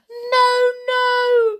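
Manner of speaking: very high, loud wail; begging. A narrator's reading. Doomed witch begs her leader, high terrified wail. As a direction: wail it in terror. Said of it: "begged the wo-, witch".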